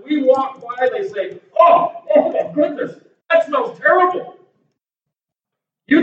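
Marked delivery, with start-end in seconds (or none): 1.6-4.4 higher-pitched appalled exclamation; mock disgust. Preacher imitating passers-by who smell something terrible, mock disgust. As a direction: recoil loudly from a stench. Acted bystanders recoiling from smell.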